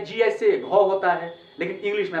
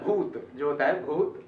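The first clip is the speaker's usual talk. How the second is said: ordinary delivery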